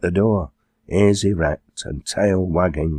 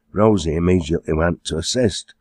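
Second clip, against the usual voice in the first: ordinary delivery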